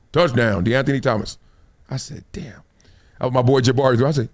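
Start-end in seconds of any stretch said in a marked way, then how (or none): 1.9-2.6 softer, stunned "Damn."; stunned murmur. Retold or imitated. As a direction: breathe it out in disbelief. Stunned by fourth touchdown.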